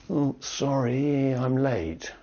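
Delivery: acted apology. Student arriving late to class, acted apology. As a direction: lightly act out a sheepish late arrival. Acting a latecomer apologising to teacher.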